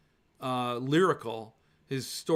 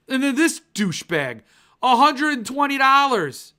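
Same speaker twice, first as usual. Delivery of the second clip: outraged complaint; 8 semitones higher, 7 dB louder than usual. Real, not acted. Outrage at seller's price.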